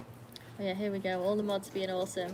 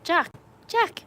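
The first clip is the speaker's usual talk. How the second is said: much higher, loud call; calling the dog; 9 semitones higher, 11 dB louder than usual. Real, not acted. Owner calling her dog Jack, high and loud. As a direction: call out to the dog across the yard.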